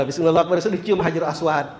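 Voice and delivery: normal talking voice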